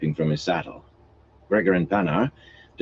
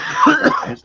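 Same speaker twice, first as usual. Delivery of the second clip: cough; 7 dB louder than usual. Acted. Young man coughs.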